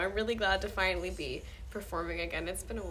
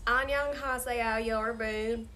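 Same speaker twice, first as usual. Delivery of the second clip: Korean with a broad American Southern twang; Southern-accented Korean; 4 dB louder than usual. Shown as a demonstration. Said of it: "Korean in a southern accent".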